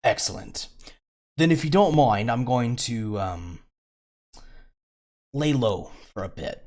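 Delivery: slippery rogue pleased with himself, only lightly put on; cocky smuggler who just broke out of his cell; smuggler NPC.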